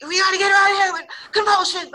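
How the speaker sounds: high, loud, fast urgent shout; yelling. Retold or imitated. Medical tech yelling for her sergeant, urgent shout. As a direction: re-enact a yelled voiceover line. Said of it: "so I'm yelling that in, in the looping".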